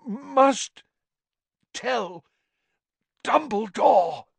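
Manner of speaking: high, slow, each word forced out; straining effort. A narrator's reading. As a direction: squeeze every word out painfully. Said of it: "seemed to cost him a terrible effort".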